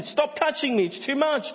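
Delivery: very high, loud mock complaint; exasperated protest. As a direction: whine it loudly as pestered Elijah. Voices Elijah protesting the touches.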